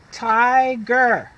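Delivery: "Tiger" called out loud; loud drill word. Shown as a demonstration. Teacher calling out the word to children, loud. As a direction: call it out for the class. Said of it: "Say it with me".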